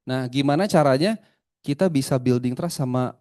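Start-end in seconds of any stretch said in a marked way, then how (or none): none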